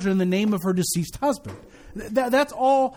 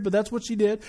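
normal talking voice

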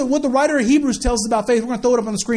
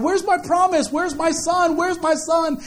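higher-pitched, repeated demand; acted-out nagging appeal; insistent pleading; 6 semitones higher, slower than usual. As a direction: imitate someone pestering God for an answer. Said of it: "over and over asked God".

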